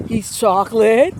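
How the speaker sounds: slow, grinning sales pitch; imitating a friend playing matchmaker, with a grin; smiling tease. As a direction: sell it with a sly smile. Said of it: "He's smiling".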